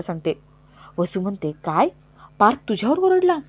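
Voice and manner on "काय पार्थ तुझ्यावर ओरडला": shocked disbelief. Acted. Vasu hears shocking news.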